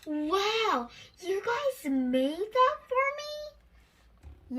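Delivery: Lucy sees the flower castle, amazed. Acted. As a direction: marvel at the surprise.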